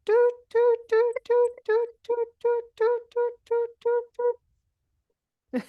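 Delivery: very high, repeated doot like a truck's reversing beep; high owl tooting for the kids; saw-whet owl toot. Shown as a demonstration. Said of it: "do your best Saw Whet Aloe impression".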